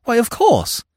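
high-pitched burst of realisation; sudden high, delighted exclamation; exclaiming. A narrator's reading. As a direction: cry out as it dawns on you. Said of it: "he exclaimed".

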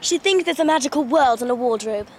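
crisp English accent without Rs. Shown as a demonstration. Demonstrating a Narnia line, English accent. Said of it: "I like the English accents from that one".

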